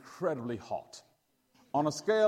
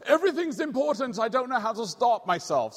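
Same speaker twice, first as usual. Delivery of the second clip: high, louder exasperated cry; frantic kid; 8 semitones higher, 5 dB louder than usual. Retold or imitated. Boy underlining every sentence, high and louder in exasperation. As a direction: blurt it out like a boy who can't stop.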